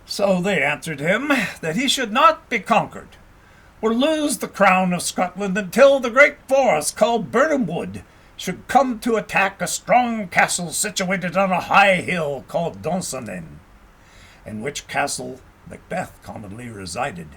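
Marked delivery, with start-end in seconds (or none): none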